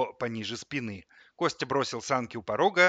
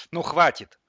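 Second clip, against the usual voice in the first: fed up; 5 semitones higher, 9 dB louder than usual. A narrator's reading. Boy stops the squabble.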